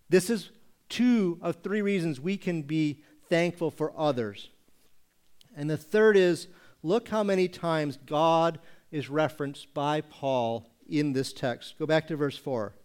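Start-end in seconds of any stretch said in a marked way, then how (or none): none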